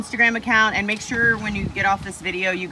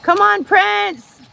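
calling out; 13 semitones higher, 9 dB louder, much slower than usual. Real, not acted. Calling out to crocodile.

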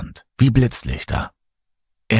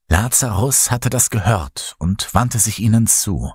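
ordinary delivery, nothing marked

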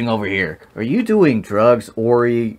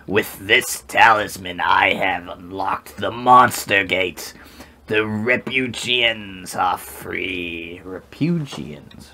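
slow, booming villain gloat; slow theatrical villain menace; 2 semitones lower, slower than usual. Acted. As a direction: gloat slowly like a cartoon villain. Voicing the game villain.

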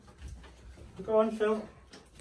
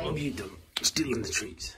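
whispering; 2 semitones lower, 4 dB quieter, faster than usual. Real, not acted. Whispered aside about dog.

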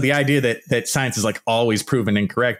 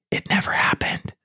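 whispered it never happened; soft whispered aside; 3 dB quieter than usual. Shown as a demonstration. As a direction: breathe it out like a secret. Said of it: "I'm gonna say it a bunch of times".

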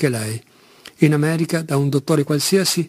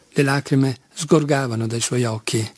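ordinary delivery, nothing marked

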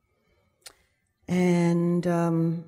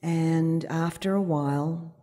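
usual speaking voice

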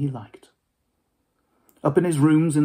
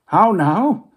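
put-on higher, louder cutting old man; caustic and cold; 7 semitones higher, 9 dB louder than usual. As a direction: snap it coldly at the ghost. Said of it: "caustic and cold as ever".

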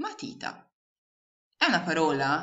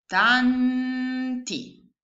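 higher, slow, stretched out "tanti" split as TAN-TI; syllable by syllable; 8 semitones higher, 5 dB louder than usual. Shown as a demonstration. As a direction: sound out "tanti" one syllable at a time. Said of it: "La prima parolina è". ("tanti" scandito sillaba per sillaba)

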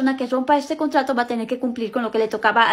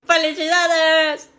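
excited cheer; 4 semitones higher, 7 dB louder, much slower than usual. Acted. Kids greeting mom, celebrating.

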